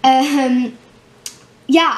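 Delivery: normal talking voice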